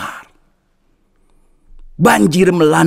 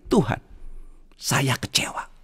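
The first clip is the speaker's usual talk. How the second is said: soft whispered complaint; disappointed; 3 semitones lower, 11 dB quieter than usual. Acted. Dead man telling Jesus of his disappointment, whispered. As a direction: breathe out the disappointment.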